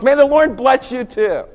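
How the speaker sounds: loud, high chorus-style answer; hearty reply. Pretend. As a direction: return the blessing across the office. Imagined office answers the greeting.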